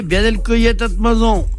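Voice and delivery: usual speaking voice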